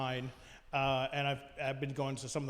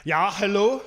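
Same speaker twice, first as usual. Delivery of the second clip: loud hammed-up German scientist; booming German accent, loud and proud; 3 semitones higher, 7 dB louder than usual. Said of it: "thick German accent".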